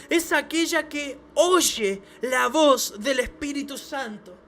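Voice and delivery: slow, loud, drawn-out proclamation; preaching shout. Acted. Preacher to church, slow loud shout. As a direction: call it out slowly to the congregation. Preacher stresses hearing the Spirit.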